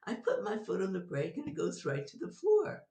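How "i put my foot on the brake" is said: ordinary talk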